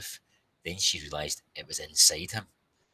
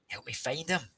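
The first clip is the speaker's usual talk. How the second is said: lightly raised call for help; mild yell; 12 semitones higher than usual. Acted. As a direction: call out a touch urgently. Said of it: "she yelled".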